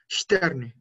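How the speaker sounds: STERNE opening on SH, "shterne"; S como sh. Shown as a demonstration. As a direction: say "Sterne" with a strong SH before the T. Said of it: "S antes de la T suena sh fuerte". ("Sterne" pronunciado "shterne")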